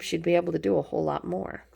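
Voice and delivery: usual speaking voice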